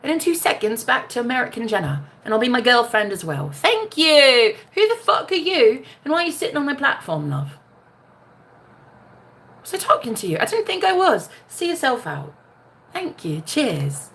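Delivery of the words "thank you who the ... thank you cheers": put-on cheeky British accent, higher. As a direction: dismiss them in a mock London accent. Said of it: "accent switch".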